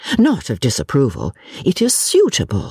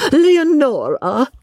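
high, drawn-out theatrical flourish; grand announcement; 13 semitones higher, slower than usual. Acted. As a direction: savour the name, stretching it out high. Novelist proudly names her heroine.

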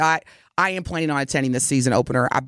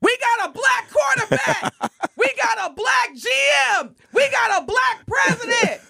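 exasperated shout. Real, not acted. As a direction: yell it at the quarterback, fed up. Ranting at the quarterback.

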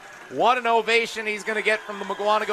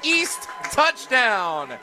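excited play-by-play call; 11 semitones higher, 5 dB louder, slower than usual. Real, not acted. Live touchdown call.

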